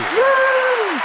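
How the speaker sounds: high, drawn-out cheering "woo"; excited whoop. Real, not acted. Guest cheers after the host's big welcome, excited whoop. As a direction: let out a high-pitched whoop.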